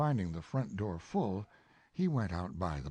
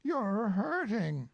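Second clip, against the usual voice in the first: lightly voiced Winnie-the-Pooh, polite; 14 semitones higher, 3 dB louder, slower than usual. Pooh, slow.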